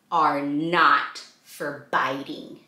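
low slow warning; low deliberate rule; slow firm refrain. A narrator's reading. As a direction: lay down the rule gently. Reading book refrain slowly.

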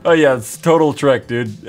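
ordinary talk, nothing marked

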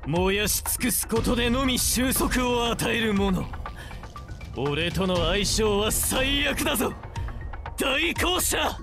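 high-pitched, impassioned villain's boast; theatrical avenger in full cry; menacing battle declaration. Acted. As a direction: proclaim your title to doom the foe. Avenger's battle declaration against the Executor.

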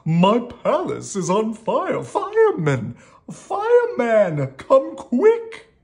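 loud, high, drawn-out call for help; hammed-up royal yell, stretched words; yelling king. A narrator's reading. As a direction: shout for the fireman as a panicked storybook king. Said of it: "yelled the king".

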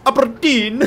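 loud, high mock-Scottish cry; bad Scottish accent. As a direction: call it out in a dire Scottish accent. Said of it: "That is a diabolical Scottish accent".

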